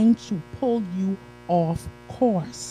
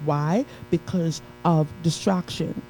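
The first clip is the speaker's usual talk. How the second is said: ordinary delivery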